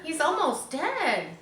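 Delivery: put-on high, slow little-girl voice; girl's voice. A narrator's reading. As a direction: say it like a worried young girl. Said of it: "said Fern".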